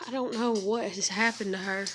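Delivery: ordinary delivery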